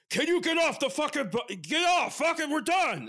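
high, loud exasperated shout; irate driver yelling. Retold or imitated. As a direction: bellow it like a fed-up bus driver. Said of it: "he's like".

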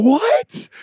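high, startled What; mock shock. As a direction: play the congregation's shock. Mock audience reaction.

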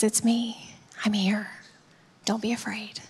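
low, hushed comfort; gentle reassurance. Acted. Jesus to those facing life's hardest, tenderly reassuring. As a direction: soothe softly, almost whispering.

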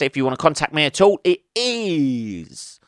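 ordinary talk

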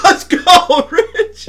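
very high, loud laughing cheer; high laughing "let's go"; hyped cheer. Retold or imitated. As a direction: shout it like a fired-up dad. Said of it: "it got me hyped".

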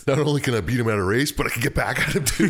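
thrilled, laughter spilling into the words; laughing glee. Real, not acted. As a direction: gleefully crack up while talking. Gleeful reaction to revenge chance.

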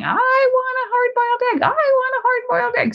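put-on high wailing kid's demand; screaming child. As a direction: whine it like a furious four-year-old. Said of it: "he's screaming and crying".

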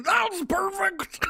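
gleeful character shout. Pretend. Comedy character's gleeful reply.

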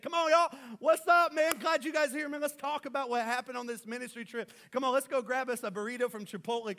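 high, loud, exuberant greeting; excited Jesus. Acted. Preacher plays Jesus greeting his disciples back from ministry, excited shout. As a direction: shout it like hyped-up high-fiving with the boys. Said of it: "he was so excited".

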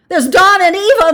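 very high, loud protest; yelled. A narrator's reading. Wife objects to the draw, yelling. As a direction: yell it in desperation. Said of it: "Missus Hutchinson yelled".

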